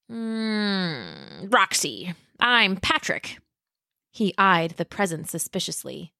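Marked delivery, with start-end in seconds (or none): 0.1-3.4 slow, lightly voiced dinosaur boy; a hint of a slow, easygoing dinosaur; Pat the dinosaur. Narrator voices Pat the pachycephalosaurus.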